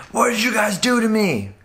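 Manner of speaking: high-pitched, indignant accusation; retold outburst at friends; acted outraged demand. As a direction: confront them in a high, worked-up voice. Bursts into camp confronting his friends.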